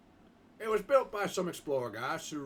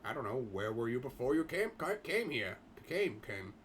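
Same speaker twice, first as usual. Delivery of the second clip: ordinary talk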